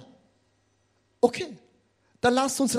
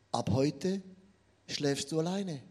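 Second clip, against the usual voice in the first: softer, lower, hushed; soft ominous; 6 semitones lower, 8 dB quieter than usual. Acted. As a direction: drop the voice for the second point. (leise und tiefer gesprochen)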